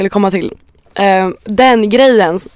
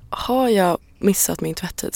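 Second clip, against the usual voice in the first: slow, hushed self-questioning; worried inner thought; 9 dB quieter, slower than usual. Retold or imitated. Reader interrupted by chores in mind, quietly fretting. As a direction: voice a nagging thought softly to yourself. Acted intrusive thoughts while reading.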